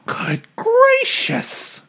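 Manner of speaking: very high slow gasp of surprise; shocked bird voice. Acted. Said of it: "gasped Maisie".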